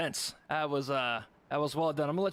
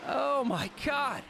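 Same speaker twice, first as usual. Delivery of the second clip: stunned; 8 semitones higher, slower than usual. Real, not acted. Reacting to the finish.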